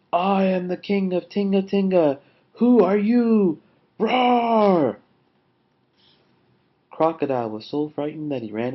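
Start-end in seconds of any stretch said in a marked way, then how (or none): 0.1-5.0 high, loud challenge building to a roar; mighty roar. A narrator's reading. Lion faces down Crocodile, roaring. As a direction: take a breath and bellow like a king. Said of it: "Lion took a deep breath".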